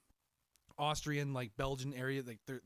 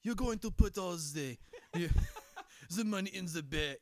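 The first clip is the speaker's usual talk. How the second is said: put-on French villain accent; 5 semitones higher, 5 dB louder than usual. Co-host imagining a French movie bad guy, mock French accent. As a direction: do a mock French gangster, half laughing. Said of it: "could you really imagine a French bad guy".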